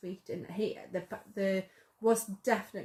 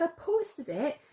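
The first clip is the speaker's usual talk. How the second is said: excited retelling, pitched higher, in a loose put-on London voice; imitating his accent; 6 semitones higher than usual. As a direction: tell it the way he told it, excited. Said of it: "I can't do the accent".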